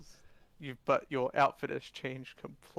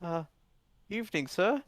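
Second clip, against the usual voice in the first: timid, higher-pitched greeting; meek; 5 semitones higher than usual. Acted. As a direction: approach meekly, voice lifted. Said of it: "meekly comes up and goes".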